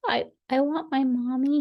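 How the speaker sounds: high, small, halting little voice; tearful duckling. A narrator's reading. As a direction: whimper as a tearful baby duckling. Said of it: "A fat little tear".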